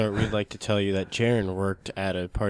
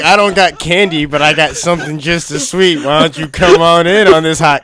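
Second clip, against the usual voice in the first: loud, high, hammed-up creepy invitation; creepy host; 5 semitones higher, 11 dB louder than usual. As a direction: lure the kids in like a cartoon creep. Parodies the creepy host's invitation.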